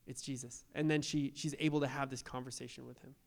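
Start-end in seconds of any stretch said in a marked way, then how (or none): 0.0-0.7 hushed realization. Retold or imitated. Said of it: "And she goes".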